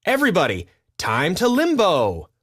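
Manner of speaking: party host calling out. Acted. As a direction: call the whole crowd over. Calling everyone to limbo.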